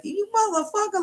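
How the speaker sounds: very high mock cussing; cussing grandpa. Imitating grandpa cussing at everybody, aggravated. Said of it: "they start cussing at everybody".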